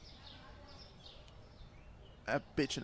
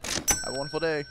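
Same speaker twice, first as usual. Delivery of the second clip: ordinary talk, nothing marked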